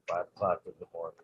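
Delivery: normal talking voice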